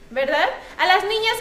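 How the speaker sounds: normal talking voice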